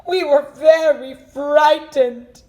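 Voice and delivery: high, slow, loud frightened voices; hammed-up fear; mock frightened. Rescued pair speak.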